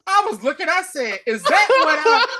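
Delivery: can't stop laughing. Real, not acted.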